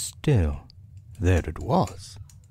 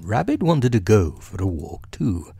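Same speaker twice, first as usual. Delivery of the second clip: ordinary delivery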